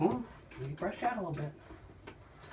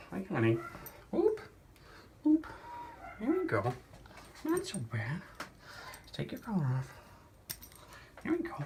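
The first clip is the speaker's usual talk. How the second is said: soothing a scared cat; 3 semitones higher, 8 dB quieter than usual. Real, not acted. Bathing a reluctant cat.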